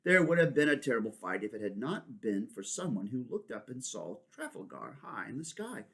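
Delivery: ordinary delivery, nothing marked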